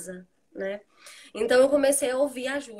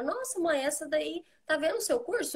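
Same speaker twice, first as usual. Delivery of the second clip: lightly voiced child, higher pitch; imitating daughter; 6 semitones higher, 3 dB quieter than usual. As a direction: voice a curious girl talking to her mother. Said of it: "ela fala". (imitando de leve a filha, voz mais aguda)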